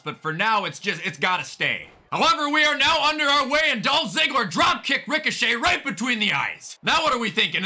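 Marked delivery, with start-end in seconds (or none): 2.1-6.8 higher-pitched flowing wrestling call; hyped ringside commentator; excited play-by-play. Pretend. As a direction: announce the opening strike with energy. Live play-by-play of wrestling match.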